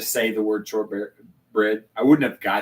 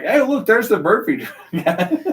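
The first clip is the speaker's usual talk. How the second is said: higher, louder mock-excited shout with a laugh; amused re-enactment of spotting someone; retold excited call-out; 8 semitones higher, 7 dB louder than usual. Excitedly retold remark, breaking into laughter.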